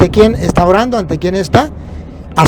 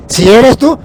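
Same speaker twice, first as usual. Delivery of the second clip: a hesitant "Si eres tú", rising at the end; doubtful quote; 4 semitones higher, 4 dB louder, slower than usual. Retold or imitated. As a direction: say "Si eres tú" a bit louder, with a questioning rise on "tú". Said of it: "no estaba muy seguro".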